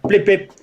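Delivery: high mock electronic blips; imitated blip blip. Officer imitating the camera switching on, high mock beeps. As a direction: beep it out like the camera. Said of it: "don't like".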